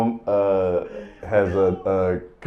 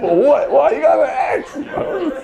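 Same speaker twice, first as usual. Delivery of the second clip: much higher, cracking up mid-question; laughing disbelief. Real, not acted. Asking the firefighter why he has an axe, laughing high. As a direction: ask it while laughing in disbelief.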